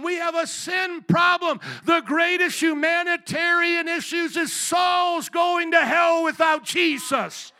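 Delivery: high, loud climactic cry; full-force preaching. Real, not acted. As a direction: drive the warning home at a shout.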